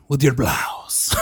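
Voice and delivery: slow mock Spanish accent; slow, hammy Spanish-accented movie quote; Nacho Libre. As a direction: draw it out in a bad Spanish accent. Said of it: "like Nacho Libre style".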